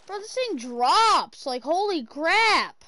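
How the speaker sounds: loud, high amazement; excited. Real, not acted. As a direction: shout it out in awe. Amazed at steep drop.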